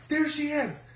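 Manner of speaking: very high excited exclamation; imitated excited spotting. Teammate spotting the portrait, high excited imitation.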